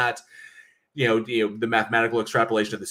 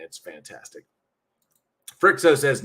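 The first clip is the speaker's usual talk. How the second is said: ordinary delivery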